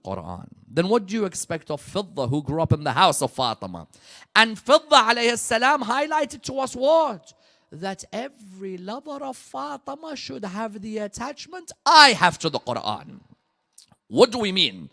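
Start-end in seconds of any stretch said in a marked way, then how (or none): 4.3-14.9 high, loud, driving sermon delivery; preaching at full force. Acted. Preacher praising Fidda's bravery to the congregation, at full force. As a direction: lift the congregation with rising fervour.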